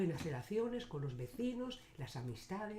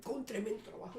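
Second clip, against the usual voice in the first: mock straining; 7 semitones higher than usual. Acts lifting the heavy sack.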